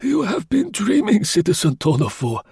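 put-on high old voice, lightly chuckling; old concierge gently teasing; amused old man. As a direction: brush it off like a doddering old man. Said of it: "with a husky little laugh".

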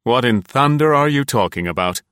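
curt, gruff question; brusque. A narrator's reading. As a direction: snap the question out bluntly. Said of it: "it was brusquely".

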